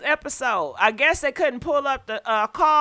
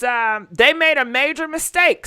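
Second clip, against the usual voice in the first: normal talking voice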